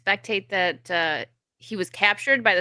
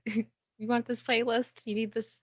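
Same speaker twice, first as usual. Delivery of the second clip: Delilah radio voice; 2 semitones higher, 6 dB quieter than usual. Retold or imitated. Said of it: "do it in, like, a Delilah voice".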